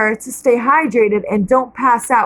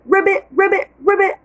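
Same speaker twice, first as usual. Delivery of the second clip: frog croaks; 7 semitones higher than usual. A narrator's reading.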